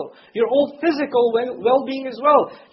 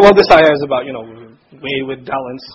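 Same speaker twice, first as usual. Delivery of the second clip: a lightly voiced shrug of a listener; dismissive listener; 9 semitones lower, 5 dB louder than usual. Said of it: "So we say".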